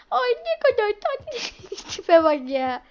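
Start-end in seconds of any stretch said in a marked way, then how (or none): 0.1-1.4 put-on cooing at baby.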